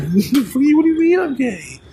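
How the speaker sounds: high, incredulous mock voice; mock outraged question. Acting out the scene.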